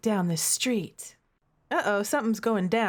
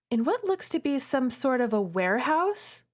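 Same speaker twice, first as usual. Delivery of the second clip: ordinary delivery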